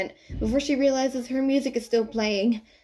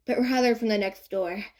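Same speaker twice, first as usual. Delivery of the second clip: usual speaking voice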